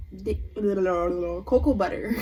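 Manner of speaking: words slurred through a laugh; giggling, slow and broken up. Real, not acted. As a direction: lose it laughing mid-sentence. Laughing mid-story.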